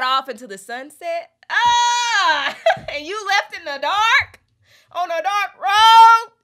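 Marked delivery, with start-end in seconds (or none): 1.5-2.6 a loud, high cry breaking into a laugh; real anguished half-laugh, half-shout; loud high laughing wail. Real, not acted. Overwhelmed by the lyric. 2.9-6.3 loud, high, laughing outrage; real incredulous laugh-shout; high, loud, disbelieving laugh, slowing on "dark road"; laughing disbelief. Real, not acted. Overwhelmed by the lyric.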